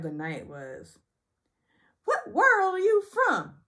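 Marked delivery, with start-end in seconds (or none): 2.0-3.5 high, loud, slow jab; high, loud, slowed mock taunt; mock Sutton. The line of the night.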